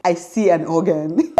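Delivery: real laugh bursting out. Real, not acted. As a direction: let a laugh take over as you quote. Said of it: "Guys, I'm laughing now".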